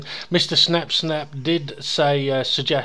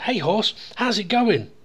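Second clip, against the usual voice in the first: raised-pitch greeting to an animal; joke's Englishman; 6 semitones higher than usual. Acted. Englishman greets the Welshman's dog, higher chatty greeting.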